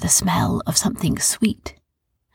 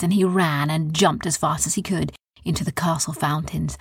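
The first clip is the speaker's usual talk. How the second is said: usual speaking voice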